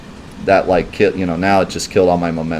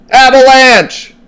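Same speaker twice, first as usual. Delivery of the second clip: yelled punchline; 12 dB louder, much slower than usual. Pretend. Streamer delivering his Final Fantasy joke's punchline, yelled. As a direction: joke punchline bellowed high and loud, drawn out. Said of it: "the reason I yelled it".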